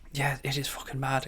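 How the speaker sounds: hushed, unvoiced whisper. Real, not acted. Streamer whispers the swear to his viewers, whispering. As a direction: drop to a whisper for the swear word.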